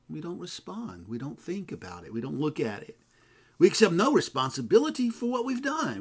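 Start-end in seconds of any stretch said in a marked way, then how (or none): 3.6-6.0 preaching. Acted. Preaching emphasis.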